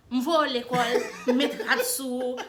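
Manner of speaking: put-on Oka accent. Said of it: "that's how you guys talk".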